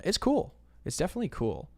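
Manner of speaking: high, loud burst; excited. Real, not acted. Admitting about AI.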